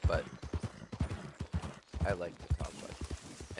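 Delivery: ordinary delivery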